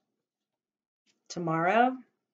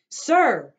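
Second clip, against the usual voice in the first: loud, high shout; 8 semitones higher, 13 dB louder than usual. Acted. Grandfather shouting at the cart man, loud shout. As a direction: shout him down. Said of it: "he shouted".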